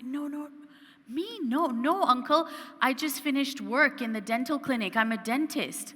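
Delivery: high, surprised no no; taken aback, quickly correcting him; flustered protest. Retold or imitated. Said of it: "And I'm like".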